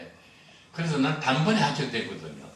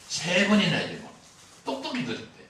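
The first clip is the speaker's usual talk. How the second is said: ordinary talk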